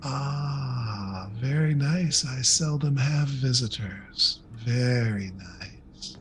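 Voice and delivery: slow, savoring mistress of the lair; regal mistress. Acted. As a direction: languid throne-room welcome. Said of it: "she looks down and goes".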